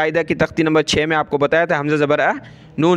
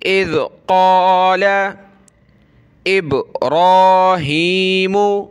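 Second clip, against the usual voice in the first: slow sung recitation, a bit louder; Quran recitation; 3 semitones higher, 3 dB louder, much slower than usual. Shown as a demonstration. Teacher recites the verse slowly for pupils. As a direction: intone it slowly like tilawat.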